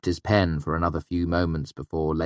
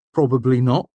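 raised-pitch uncertain admission; 7 semitones higher, 7 dB louder than usual. A narrator's reading. Sleepless Manet answers Simon, uncertain. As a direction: admit it, not quite sure. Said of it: "Manet said uncertainly".

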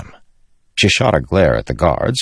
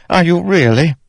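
very high, insistent question; pained, challenging young king; disbelieving; 14 semitones higher, 4 dB louder than usual. Acted. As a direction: press your doubt harder, voice up high. Elhokar doubts the apology.

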